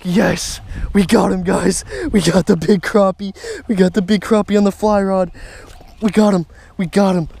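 loud, high victory yells; real triumphant shout. Real, not acted. Angler lands big crappie on fly rod, triumphant shouting. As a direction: shout it out like you've just won.

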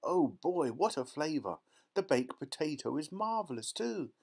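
high, rapturous relishing; excited child marvelling at a feast; thrilled girl. A narrator's reading. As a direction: savour every mouthful with delight. Violet marvels at the magic meal.

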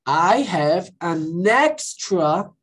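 very slow, clear I have an extra; teacher repeating slowly; slow model. Shown as a demonstration. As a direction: draw out each word for the class. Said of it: "That's okay".

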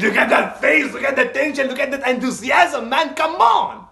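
high, rising, hyped exclamations; excited outburst. Real, not acted. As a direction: build the hype up to a loud peak. Thrilled reaction to a tense scene.

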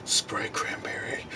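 slow, breathy whisper from the dark corner; eerie drawn-out whisper; creepy whisper. Pretend. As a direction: whisper it slowly like a lurking creep. Creepy roommate crouching in corner.

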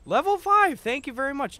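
high, loud hype call; excited shout. Real, not acted. Streamer hyping the level five train, excited shout. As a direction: announce it like a hype man.

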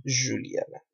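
"Juliana" softly, starting with zh; Portuguese pronunciation. Shown as a demonstration. Teacher repeats the Portuguese name, Portuguese pronunciation. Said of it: "en portugués decimos Juliana".

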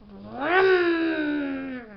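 loud, long revving engine noise; drawn-out imitated engine roar; engine vroom. A narrator's reading. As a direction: rev up high and loud like a race car.

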